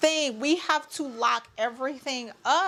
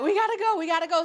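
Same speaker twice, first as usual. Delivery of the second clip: high, urgent, imitated panic; retold rush to the hospital, pitched up; panicked urgency; 7 semitones higher, much faster than usual. Panicked mother retelling rush.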